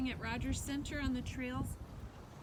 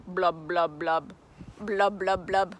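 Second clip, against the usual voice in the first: fish bubbles; 4 semitones lower, 8 dB louder than usual. Pretend. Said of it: "Maybe he's blowing bubbles".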